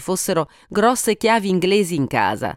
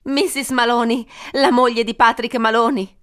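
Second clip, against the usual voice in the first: higher, agitated voice on the call; identify yourself in mock distress, pitched up; distraught; 6 semitones higher than usual. A narrator's reading. Wife gives her name to police, distraught.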